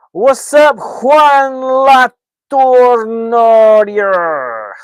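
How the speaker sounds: very high, very loud, long-held name; bellowed greeting. Real, not acted. Streamer welcomes a viewer by name, bellowed greeting. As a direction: stretch the viewer's name into a huge shout.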